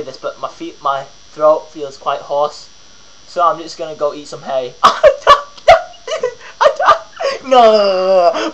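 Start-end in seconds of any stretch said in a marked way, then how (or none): 4.8-7.5 high, loud whinnying laugh; mock horse whinny. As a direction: neigh like a horse after the hay joke. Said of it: "a horse joke".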